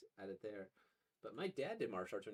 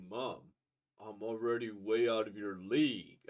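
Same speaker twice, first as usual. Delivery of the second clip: mock-boastful raised voice; loud taunting boast; 9 dB louder than usual. Manga daughter bragging to her mom, loud mock taunt. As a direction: call out the brag loudly, imitating the character.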